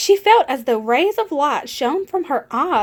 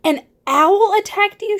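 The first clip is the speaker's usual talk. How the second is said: higher pitched, alarmed ask; startled question; 8 semitones higher, 4 dB louder than usual. A narrator's reading. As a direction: sound startled by the news. Mother bat realizes.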